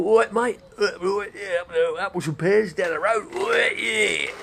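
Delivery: loud, high Cockney caricature; working-class Cockney. Acted. Imitating Coward before he acquired an accent, loud Cockney. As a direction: bellow it like a Cockney barrow boy. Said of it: "He was a working class boy".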